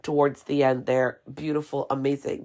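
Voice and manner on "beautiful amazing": normal talking voice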